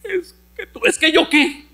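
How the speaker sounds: a put-on teary, high wife's voice; tearful wife. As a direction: whimper it out like a hurt wife. Imita a la esposa llorosa. (voz llorosa y aguda de esposa, en broma)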